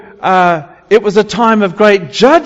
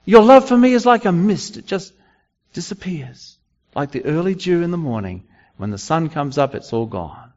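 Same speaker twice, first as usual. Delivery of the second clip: fading disappointed God; 5 dB quieter than usual. Retold or imitated. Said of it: "God is saying".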